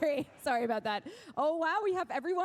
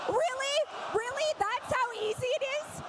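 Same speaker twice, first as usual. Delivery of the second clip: much higher, incredulous really; stunned disbelief; 10 semitones higher than usual. Real, not acted. Co-host reacts to the audience bribe, stunned disbelief. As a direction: sound amazed it's that easy.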